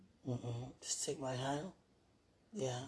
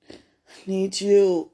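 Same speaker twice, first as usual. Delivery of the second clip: loud, higher cry of need breaking out; tearful plea; 7 semitones higher, 14 dB louder, slower than usual. Real, not acted. Calling out to a lost loved one, loud and tearful. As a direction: let the longing burst out, near tears.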